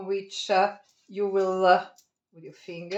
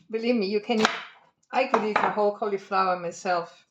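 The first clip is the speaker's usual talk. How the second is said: usual speaking voice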